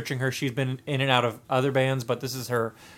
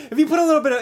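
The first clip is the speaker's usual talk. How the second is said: high, loud laughing talk; cracking up while justifying yourself; 11 semitones higher, 7 dB louder, faster than usual. Real, not acted. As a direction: talk through your own laughter. Cracking up over cream soda rating.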